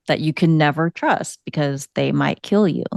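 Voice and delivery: usual speaking voice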